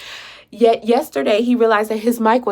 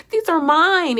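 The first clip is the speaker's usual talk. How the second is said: usual speaking voice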